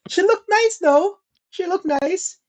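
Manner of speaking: high-pitched, insistent concession; squeaky-high half-hearted defence; defensive backpedalling. Real, not acted. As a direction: pitch way up, as if softening the insult. Backpedalling after the joke.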